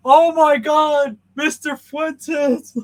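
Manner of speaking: imitating a stunned fan, high drawn-out yell; amazed shouting. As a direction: holler it slowly in mock awe, very high and loud. Acting out coworker's amazed greeting.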